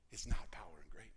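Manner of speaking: whispered, fast and hushed. Acted. Preacher whispering the punchline to his congregation. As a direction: drop to a whisper to land the point.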